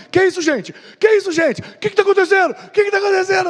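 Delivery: high, rising alarm building to a shout; a guy waking up with no idea what's going on; confused panic. Retold or imitated. Confused boy in retold prank. (rapaz confuso e assustado, gritando no fim)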